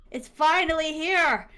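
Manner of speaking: high, loud, drawn-out joy; jubilant cry. Retold or imitated. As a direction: burst out with it, stretching "finally". Dream arrives, second louder take.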